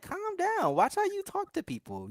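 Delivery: high-pitched scolding imitation; high mock scolding. Mamas telling an angry man to calm down, high and scolding. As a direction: squeak it out like a prim scold. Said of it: "it's like".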